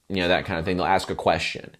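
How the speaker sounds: ordinary talk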